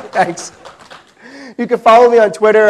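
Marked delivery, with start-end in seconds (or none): 1.6-2.7 repeated louder. Real, not acted. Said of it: "So you can follow me on Twitter".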